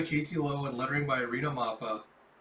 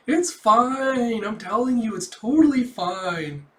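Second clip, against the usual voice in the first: loud, raised, drawn-out reassurance; emphatic insistence; 7 semitones higher, 8 dB louder, slower than usual. Acted. Melinda brushing off Yor's apology, loud insistence. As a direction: insist loudly, stretching the last words.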